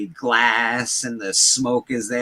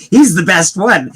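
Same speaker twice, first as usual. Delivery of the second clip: louder, higher, slow, laughing; laughing quote; 6 semitones higher, 9 dB louder, slower than usual. Retold or imitated. Friend echoing the childhood line, laughing and loud. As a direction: laugh it out while mocking the kid.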